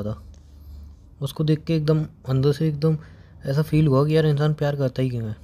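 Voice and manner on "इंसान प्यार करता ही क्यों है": ordinary talk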